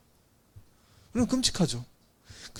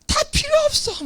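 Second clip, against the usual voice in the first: much higher, mock tantrum cry; yelling child. Shown as a demonstration. Pastor acts an angry child refusing everything, high yell. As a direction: throw the words like a kid slamming things. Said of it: "막 피치를 엄청 높이는 거".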